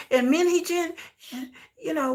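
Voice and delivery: usual speaking voice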